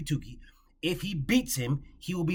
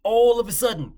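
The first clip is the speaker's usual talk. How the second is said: loud mocking burst; 5 semitones higher, 7 dB louder than usual. Real, not acted. Mocking repeat.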